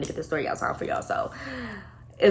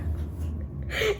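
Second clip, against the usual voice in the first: breathy laugh; 7 dB quieter than usual. Real, not acted. Laughing at his burger.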